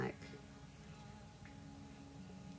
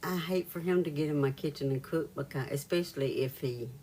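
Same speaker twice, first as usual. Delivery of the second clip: normal talking voice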